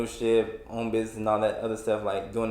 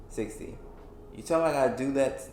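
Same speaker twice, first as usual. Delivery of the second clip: ordinary talk, nothing marked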